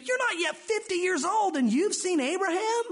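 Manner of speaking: you can't be serious, mock the claim; high, scoffing question; incredulous disbelief.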